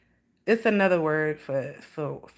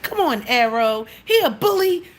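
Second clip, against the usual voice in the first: mock scolding; 4 semitones higher, 3 dB louder than usual. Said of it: "act like I was mad at my cat".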